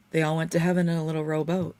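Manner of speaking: usual speaking voice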